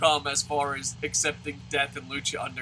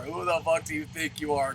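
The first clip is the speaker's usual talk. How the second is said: normal talking voice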